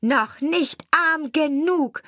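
high, slow, measured taunt; taunting sparrow. A narrator's reading. Sparrow to the lamenting carter, high deliberate taunt. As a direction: pitch it up and spell out each word at the carter.

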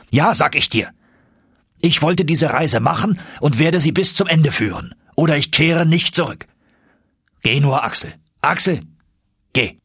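agitated, high-pitched commands; hard and threatening. A narrator's reading. Agitated uncle orders nephew to leave him, hard threatening voice. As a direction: insist harshly, worked up. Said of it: "Seine Stimme war wieder hart und drohend geworden".

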